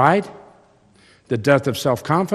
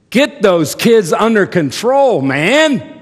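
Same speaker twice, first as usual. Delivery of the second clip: high, loud, slow disapproving lady; judging shoppers' thought; 9 semitones higher, 9 dB louder, slower than usual. Retold or imitated. Women in the store judging the dad, loud and disapproving. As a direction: scold the dad with contempt. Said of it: "you know what they are thinking".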